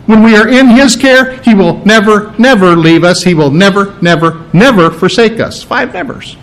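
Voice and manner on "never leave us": ordinary delivery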